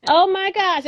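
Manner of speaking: high, loud oh my gosh; delighted and caught off guard; flattered surprise. Retold or imitated. Teacher retelling her flattered reaction to a colleague, high.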